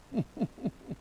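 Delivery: high, staccato little chuckle; mock self-pleased giggle; smug put-on laugh. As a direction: titter in short high bursts, self-satisfied. Mimics a self-satisfied believer.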